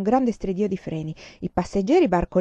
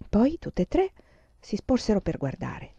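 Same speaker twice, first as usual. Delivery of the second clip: usual speaking voice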